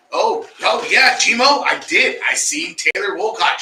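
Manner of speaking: loud, high, drawn-out excited shout-out; big excited shout-out, stretched slow. Real, not acted. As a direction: call it out like you just spotted her.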